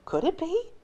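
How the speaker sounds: high astonished question; surprised. A narrator's reading. Astonished narration.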